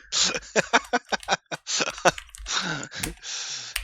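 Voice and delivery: helpless high, breathless laughter; real laughing fit; long laughing fit. Real, not acted. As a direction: crack up and keep laughing. Cracks up at the quote.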